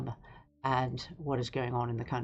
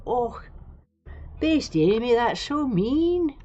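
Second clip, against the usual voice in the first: high, loud mock-hurt wail, drawn out slowly; a hammed-up cry of betrayal; mock offended; 8 semitones higher, 5 dB louder, slower than usual. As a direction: play wounded at the chat's teasing. Mock hurt at a chat comment.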